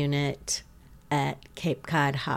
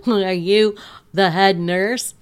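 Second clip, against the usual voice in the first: a louder, slower patient voice with a hint of suspicion; lightly voiced patient; 4 semitones higher, 8 dB louder than usual. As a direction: asks the nurse warily, slowly. Patient questions the nurse.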